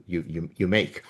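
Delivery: normal talking voice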